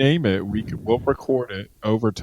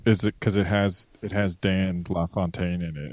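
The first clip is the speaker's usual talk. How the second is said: ordinary delivery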